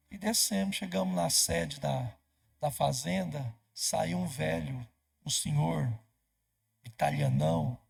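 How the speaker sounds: ordinary delivery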